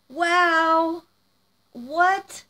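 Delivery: high, loud astonished exclamation; stunned amazement. Real, not acted. Woman opening a friend's gift, astonished. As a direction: let the wonder burst out high.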